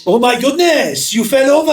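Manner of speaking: high, loud mocking French voice; mock the Frenchman's voice; mock French accent. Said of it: "I'm trying to mock the voice of the Frenchman".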